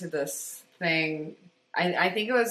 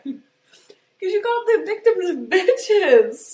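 laughing; 11 semitones higher, 4 dB louder, slower than usual. Real, not acted. Accusing while laughing.

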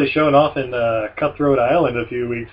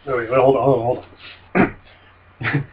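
ordinary delivery

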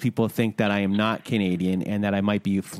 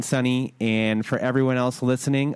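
normal talking voice

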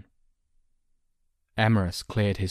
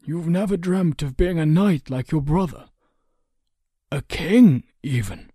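high, louder, lilting question; probing counsellor; 9 semitones higher, 7 dB louder than usual. A narrator's reading. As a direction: tempt the boy with the idea.

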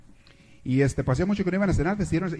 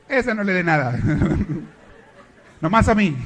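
loud, higher, chuckling jab; laughing snub; 3 semitones higher, 5 dB louder than usual. Acted. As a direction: laugh while snubbing her.

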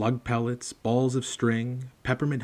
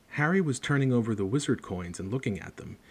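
ordinary delivery

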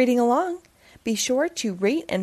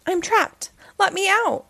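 higher, panicky pleading; mock panic; 9 semitones higher than usual. Wilford stuck under the sheet, mock panic. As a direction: play it trapped and scared.

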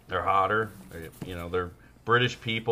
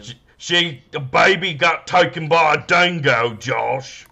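mock Australian; 6 semitones higher, 5 dB louder, slower than usual.

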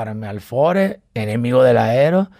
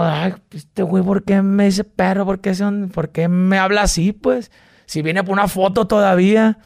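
mock offended whine, pitched up; a put-out, higher whine of disbelief; 5 semitones higher than usual. His offended thought.

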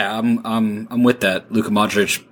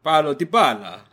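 higher-pitched Italian accent; put-on Italian pronunciation; 4 semitones higher than usual. Shown as a demonstration. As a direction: echo it with an Italian lilt. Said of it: "Say it in an Italian accent".

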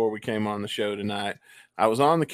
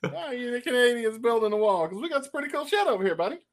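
high, quick jokey Canadian; mock Canadian accent; 12 semitones higher than usual. Host joking about a border wall in a mock Canadian accent. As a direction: rattle it off like a cartoon Canadian. Keeps the Canadian bit going.

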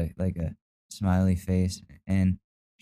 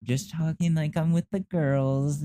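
put-on high, sing-song sarcastic girly take; mock him in a prissy voice; high sarcastic mimicry; 8 semitones higher than usual. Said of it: "mocking his text".

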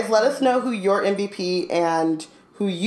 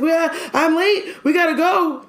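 put-on high, worried little-kid voice; panicked kid; 10 semitones higher than usual. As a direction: sound rushed and panicky, raised high.

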